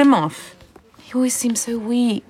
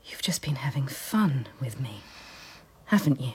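low, near-whispered accusation; hushed hurt realisation; 10 semitones lower, 11 dB quieter than usual. Acted. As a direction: speak softly as the cruelty sinks in. Realises the official toyed with her.

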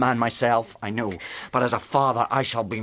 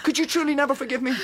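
desperate pleading; 12 semitones higher than usual. Acted. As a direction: plead with her not to go. Husband begs wife not to leave.

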